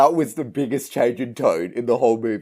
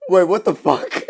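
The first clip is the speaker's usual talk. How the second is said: higher, louder, baffled outburst; stunned disbelief; 7 semitones higher, 5 dB louder than usual. Retold or imitated. A viewer reacting to the sudden mood swing, in shocked disbelief. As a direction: blurt it out like you can't believe it. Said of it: "I'm like".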